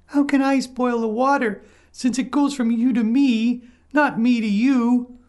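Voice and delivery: high, small lamb voice; a timid little lamb, pitched high. A narrator's reading. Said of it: "asked the lamb".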